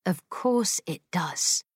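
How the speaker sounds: soft, slow, lips tight; quiet through pursed lips. Acted. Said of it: "Molly replied quietly through pursed lips".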